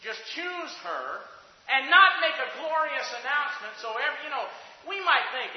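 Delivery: sermon at its peak; full-force preaching. Acted. Preaching climax.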